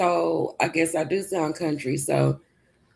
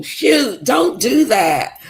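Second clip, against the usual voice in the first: higher and louder, the words drawn out slowly; a startled loud shout; startled outburst; 7 semitones higher, 7 dB louder, much slower than usual. Real, not acted. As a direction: cry out, caught off guard. Startled by a laugh.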